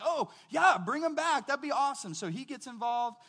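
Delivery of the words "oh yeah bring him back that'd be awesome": high, loud, delighted Pharaoh; the king suddenly thrilled; eager Pharaoh. Retold or imitated. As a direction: Pharaoh jumping at the idea. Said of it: "He's like".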